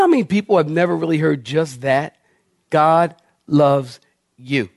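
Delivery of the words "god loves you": ordinary talk, nothing marked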